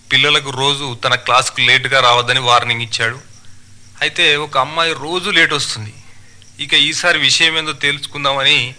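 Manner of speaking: normal talking voice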